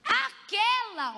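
high, slow, drawn-out teasing word; instigating, dangled slowly and high; goading. Acted. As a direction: stretch it out to bait the listener. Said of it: "olha como é que ele instiga ela".

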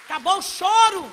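shouted call to applaud; rally the congregation loudly; loud preaching. Acted.

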